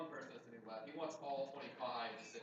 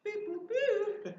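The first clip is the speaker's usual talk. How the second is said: droid beeps; 8 dB louder, much slower than usual. Pretend.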